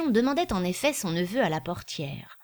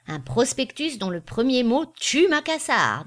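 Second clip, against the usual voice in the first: grandly emphatic, higher pitch; magisterial; 6 semitones higher, 4 dB louder than usual. A narrator's reading. Gaudissart rising to praise the prospectus, magisterial. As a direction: proclaim it like a master of the art. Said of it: "en se levant d'un air magistral".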